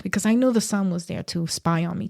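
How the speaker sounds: ordinary delivery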